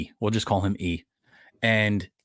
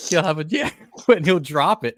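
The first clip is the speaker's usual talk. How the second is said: ordinary talk, nothing marked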